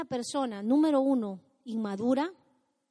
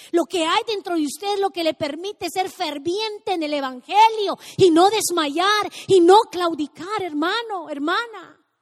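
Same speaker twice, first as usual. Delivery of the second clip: predicación ferviente; 6 semitones higher, 12 dB louder than usual. Acted. Predica con fervor.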